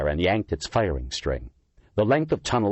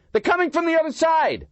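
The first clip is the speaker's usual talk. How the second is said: alarmed shout; 4 dB louder than usual. A narrator's reading. Cop warning of flank.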